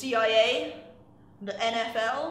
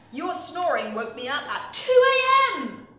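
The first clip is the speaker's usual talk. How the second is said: higher, louder, upset outburst; upset exclamation; 6 semitones higher, 9 dB louder than usual. Shown as a demonstration. Teacher shows students an upset exclamation, loud and higher. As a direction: snap it out like you were woken at night. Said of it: "You hear I'm kind of upset. There's a lot of emotion in my voice".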